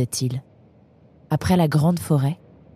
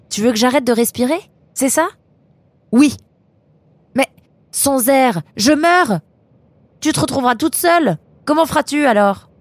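high, raised voice, melodramatic; indignant protest; 9 semitones higher, 4 dB louder than usual. Acted. Nemrod argues with Eunice about stopping breathing, melodramatic and indignant. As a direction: overplay the wounded protest.

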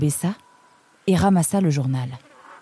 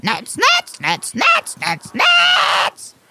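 shrieking, very high parrot voice; frantic parrot; 4 dB louder, much slower than usual. Acted. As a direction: squawk it louder and more frantic. Perroquet excité réclame des noix. (perroquet surexcité, cris suraigus)

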